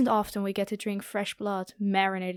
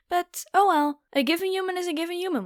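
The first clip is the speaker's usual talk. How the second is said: high, quick dismissive shrug; mock resignation; 8 semitones higher, faster than usual. Vampire dismissing the disappointing hunt, high and quick. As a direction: brush it off with a lofty sigh.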